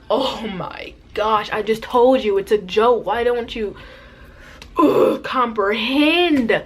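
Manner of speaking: drawn-out fed-up retort; acted exasperation. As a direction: play up the exasperation, stretching words.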